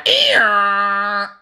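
high mock screech of a dial-up modem; dial-up modem noise. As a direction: screech like an old modem connecting. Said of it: "listen to this thing load up".

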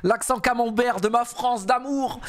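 high-pitched delighted teasing; high, mock-adoring exclamation; amused gushing. Real, not acted. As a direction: gush over it in a high, amused voice. Gushing over French player's accent.

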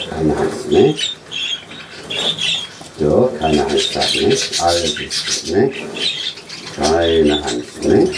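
low, soft calming voice. Real, not acted. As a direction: gently reassure a scared pet. Calming a frightened bird.

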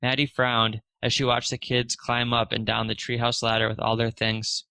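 usual speaking voice

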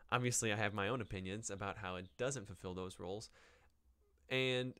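ordinary talk, nothing marked

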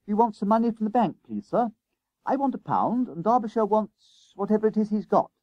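put-on high-pitched boy's request; schoolboy Jennings, polite. Jennings asks teacher for bank money, eagerly polite. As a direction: ask up high, like a pupil to sir.

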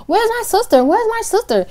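put-on high, panicked drunk calling; panicky. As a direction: call out for her sister in panic.

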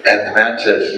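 usual speaking voice

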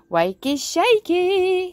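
high, loud, drawn-out squeal; a dragon's startled, excited squeak; excited gasped exclamation. A narrator's reading. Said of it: "he gasped".

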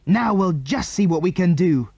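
high, excited exclamation; triumphant shout. A narrator's reading. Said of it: "shouted Andy".

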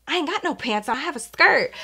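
dropping to a hushed, private aside to herself. Retold or imitated. To herself at the bar after the rip, hushed. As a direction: say it under your breath like a secret. Realizing skirt ripped at bar.

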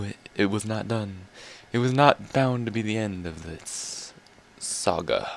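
slow, mock-epic trailer voice; slowly, like a movie trailer; epic narrator voice. Episch-dramatische Erzählstimme.

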